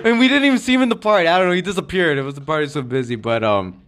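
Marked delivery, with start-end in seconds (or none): none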